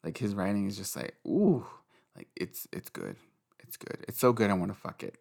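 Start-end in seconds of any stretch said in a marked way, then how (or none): 1.3-1.7 delighted ooh. Real, not acted. Savoring his writing. 2.1-4.0 soft, low breathy murmur; whispered rapture; hushed. Real, not acted. Hushed gushing about writing.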